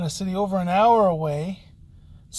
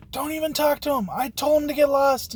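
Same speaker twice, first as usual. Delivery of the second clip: high-pitched irate woman, retold; acting out an agitated outburst; imitated yelling; 8 semitones higher, 3 dB louder than usual. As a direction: mimic her worked-up yelling, pitched well up. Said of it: "she's yelling and getting crazy".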